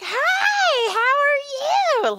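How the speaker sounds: very high, stretched singsong hello; gushing greeting. Retold or imitated. Imitates her greeting to the dad.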